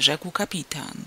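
ordinary delivery, nothing marked

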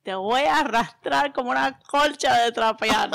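loud mock Cuban threat; hammed-up Cuban scolding; Cuban accent insult. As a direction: threaten them playfully in a thick Cuban accent. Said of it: "Insult someone in your best Cuban accent".

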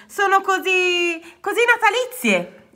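halting, thrilled voice; delighted stammer. A narrator's reading. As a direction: grope for the word with a big smile. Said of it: "farfugliò con un grande sorriso".

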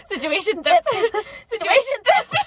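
high, giggly "thip" with th for sh; cracking up, pitch climbing; laughing at slip. Real, not acted. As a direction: repeat the lisped "thip" through laughter. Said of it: "Don't make fun of me".

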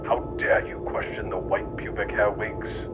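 ordinary talk, nothing marked

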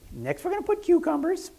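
high squeaky Pee Wee Herman impression. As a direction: chirp it like a giddy weirdo host. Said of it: "in his little Pee Wee weirdo voice".

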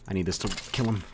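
ordinary delivery

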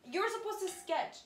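high, loud cry of dismay; exasperated self-scolding. Real, not acted. Teacher scolding themself over a skipped step, high and exasperated. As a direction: wail at your own silly mistake.

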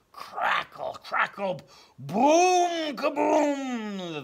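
building to a huge high thunder boom; crackle, then explode into the kaboom; bellowing thunder. A narrator's reading. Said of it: "the thunder bellowed".

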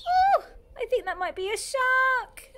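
put-on teacher gasping for kids; alarmed. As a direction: sound thrilled and scared at once. Alarmed at duck pond.

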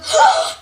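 high sharp gasp of horror; shocked gasp. Acted. Mother hears the house price and gasps high and sharp. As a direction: gasp like you can't believe it.

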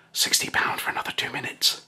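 whispered offer in the ear. Retold or imitated. Stripper whispers price to customer in ear. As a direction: whisper it close like a dancer's pitch. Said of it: "she whispers in my ear".

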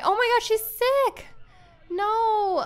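high, slow alarmed cry of surprise; shocked gasp. Real, not acted. A mother seeing her Sim daughter is sick, shocked and alarmed.

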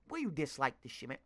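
usual speaking voice

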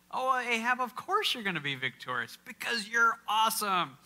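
high, gushing mock praise; mock flattering prophet. Pastor mimicking prophets flattering Ahab, gushing and high. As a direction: fawn over the king like a yes-man.

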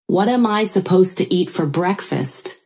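usual speaking voice

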